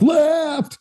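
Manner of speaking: high-pitched drawn-out mock shout; mock call-out. Runner to people in the way, mock shouted warning. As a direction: call out a warning as you run, hammed up. Mimics calling out while running past people.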